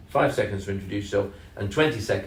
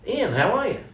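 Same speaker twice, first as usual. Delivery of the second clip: mock American cold caller, pitched high; bad American accent; 12 semitones higher than usual. Cold caller greeting a stranger by name, mock American accent. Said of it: "sounded like an American accent".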